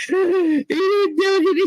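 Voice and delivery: high, slow, pleading disbelief; distraught denial. Retold or imitated. Telling her coworker her friend is innocent, high pleading disbelief. Denying friend's guilt after gasp.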